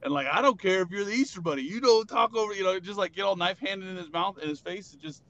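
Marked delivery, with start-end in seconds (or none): 0.3-2.5 drill sergeant. Pretend. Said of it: "like a drill sergeant".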